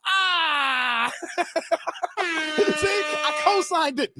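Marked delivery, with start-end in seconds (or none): 0.1-1.1 high, drawn-out gleeful cry; triumphant "ah". Real, not acted. Host vindicated by Derek's memory, high gleeful cry. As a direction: let out a high gotcha cry. Crows at viewer's recognition.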